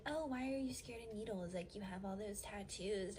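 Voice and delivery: airy, mocking imitation of a coworker. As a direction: tease it like a nosy coworker. Mocking coworkers' needle question.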